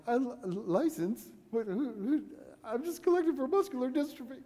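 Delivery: put-on a nervous kid caught off guard; flustered kid.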